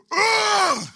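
loud, high straining heave; effortful throwing grunt. Retold or imitated. Brother hurling the snow shovel with a loud grunt. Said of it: "he just went like this".

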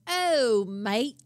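hammed-up British greeting; mock British accent. Man's bad guess at how she sounds, mock British accent. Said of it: "Oh, she's British".

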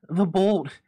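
high, loud panicked cry. A narrator's reading. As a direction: shout it out in panic. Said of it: "she cried loudly".

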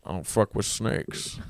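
lightly voiced gruff-hero bit, pitched low; Indiana Jones. Comic answering his friend's impression request, lightly voiced and low. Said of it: "Do Indiana Jones".

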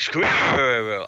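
lightly voiced puppet, word mangled; puppet mangling a word. Kim Jong-un puppet fails to say squirrel, lightly voiced puppet. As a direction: squirrel comes out garbled in a puppet voice. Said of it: "they try to get him to say squirrel".

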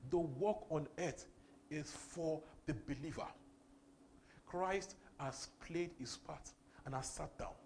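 slow, deliberate preaching; slow emphasis. Real, not acted. Preacher slowing to teach the point, deliberately slow. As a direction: lay each word down slowly. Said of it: "slow sermon emphasis".

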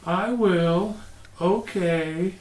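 put-on a small girl chatting dreamily to something unseen; Carol Ann. Picturing the haunted-film girl, slow eerie little girl voice. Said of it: "pictured little Carol Ann talking to the T V".

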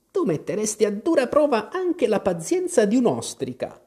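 high-pitched daughterly annoyance; a bit peeved. A narrator's reading. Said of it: "un po' stizzita". (figlia stizzita, voce acuta)

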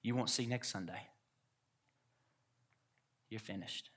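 very soft, hushed voice of the enemy; enemy's whisper. Retold or imitated. Enemy whispering to the preacher at the altar, hushed. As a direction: whisper it like an insidious inner lie, fading. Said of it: "the enemy whispered to me".

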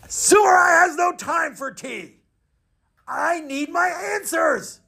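very high, shouted warrior voice; impatient samurai. Acted. Samurai refusing the master's tea, impatient shout. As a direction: demand it high and strained, stretching the words.

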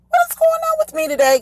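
high, quick, exasperated question; mock bewilderment. Real, not acted. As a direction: puzzle aloud at your own slip in a high voice. Flustered at her own name slip.

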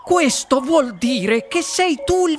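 high, drawn-out congratulations; overjoyed announcement to a winner; explosive joy. A narrator's reading. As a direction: proclaim it in high-pitched delight. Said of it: "preso da un attacco di gioia esplosiva".

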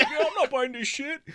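high, put-on bro voice through a laugh; a lightly voiced bro; mock shopper. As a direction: play a shopper joining in.

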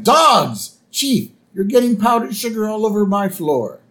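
high, loud mock exclamation into scolding; Marge. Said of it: "Marge's like".

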